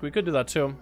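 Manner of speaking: normal talking voice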